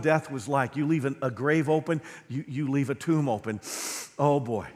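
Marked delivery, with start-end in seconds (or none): none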